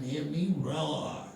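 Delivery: ordinary talk, nothing marked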